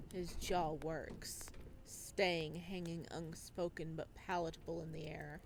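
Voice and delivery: calm, measured storytelling voice; story narration. A narrator's reading. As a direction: read it like an audiobook narrator.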